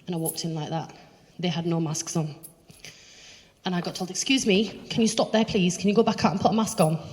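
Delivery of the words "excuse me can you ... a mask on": louder, higher voice of a shop worker; acted-out reprimand, raised voice; imitating officious challenge. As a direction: stop a customer at the door.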